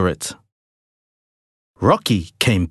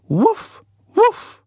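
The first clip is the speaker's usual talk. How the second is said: dog barks. A narrator's reading. Rocky barks twice, high and eager. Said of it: "barked Rocky".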